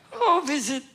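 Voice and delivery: lightly put-on voice, starting high; feigned innocent tourist. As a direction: play the harmless visitor, high at first. Acting out his nervous answer to interrogators.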